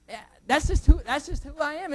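ordinary delivery

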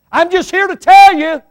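loud and high declaration; preaching shout. Real, not acted. Pastor declaring to the congregation, loud and high. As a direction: call it out to the church.

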